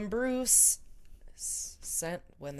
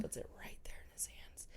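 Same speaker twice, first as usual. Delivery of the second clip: soft unvoiced whisper; 16 dB quieter, faster than usual. Real, not acted. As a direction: hush it right down. Whispering the retelling.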